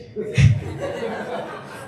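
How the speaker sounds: high laugh, voice far from normal. Real, not acted. Laughing at the mispronunciation.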